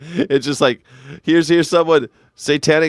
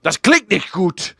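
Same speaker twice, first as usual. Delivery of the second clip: slow, higher, louder mock German complaint; German bluntness; 7 semitones higher, 5 dB louder, slower than usual. Host imitating Germans complaining about his mic, emphatically blunt. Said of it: "This is the German way".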